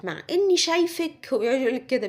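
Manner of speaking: ordinary talk, nothing marked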